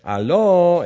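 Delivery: high, loud hail across the valley; loud mocking hello; calling out. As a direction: shout to wake the sleeping camp. David calling out to wake camp.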